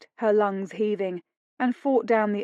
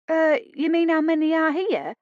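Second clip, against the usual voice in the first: higher, hesitant maid voice; nervous maid; 8 semitones higher, 3 dB louder than usual. A narrator's reading. Maid to her lord, high and unsure. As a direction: stammer it out like a scared maid.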